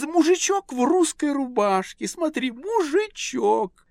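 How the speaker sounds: high, excited, affected lady; gushing society lady. A narrator's reading. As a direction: squeal over everything like a socialite. Lady gushes over paintings.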